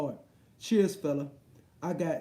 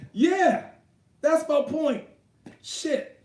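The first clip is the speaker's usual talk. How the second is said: higher and louder, eager yes; excited agreement; 8 semitones higher, 5 dB louder, much slower than usual. Real, not acted. Streamer agreeing with chat's joke, excited and louder. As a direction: jump in to agree hard.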